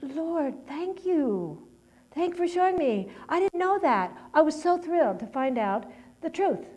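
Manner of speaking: retold prayer, lifted high with thrilled relief; thankful prayer. Speaker thanking the Lord for showing the truth, thrilled. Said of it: "I could confess it and say".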